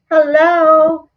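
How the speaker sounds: normal talking voice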